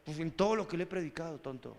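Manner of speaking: low, quick, soft aside; inner muttering. Retold or imitated. Pastor silently answers a doubting brother, muttered aside. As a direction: say it under your breath, dropping away at the end. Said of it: "yo por dentro decía".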